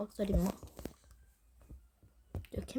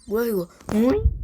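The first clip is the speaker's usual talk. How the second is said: startled; 11 dB louder than usual. Real, not acted. Startled in game.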